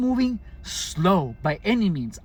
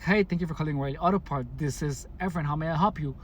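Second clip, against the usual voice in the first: fast, run-together phone greeting; the hurried way he did not answer; rushed greeting; faster than usual. Shown as a demonstration. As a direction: rattle it off in a hurry. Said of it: "but I wasn't saying it like that".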